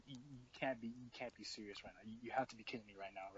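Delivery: low, very soft muttering to himself; quietly appalled, retold; hushed disbelief. Friend hearing her throw up behind the door, hushed disbelief. Hears her vomiting, can't believe it.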